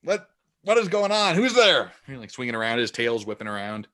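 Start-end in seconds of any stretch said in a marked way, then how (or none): 0.0-1.8 much higher and louder, frantic; blinded, panicked villain. Acted. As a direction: shout around wildly, unable to see. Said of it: "And he's like".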